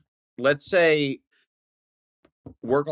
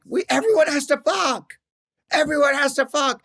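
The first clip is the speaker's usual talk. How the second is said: mock scream; 12 semitones higher than usual. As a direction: imitate someone screaming an order, pitched way up. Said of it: "[name] then would scream".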